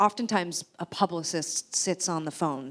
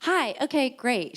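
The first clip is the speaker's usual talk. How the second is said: higher-pitched, lightly put-on phone greeting; lightly voiced woman interviewer, pitched up; imitated interviewer; 8 semitones higher, 4 dB louder, slower than usual. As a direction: greet like an interviewer opening a call. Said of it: "this woman's like".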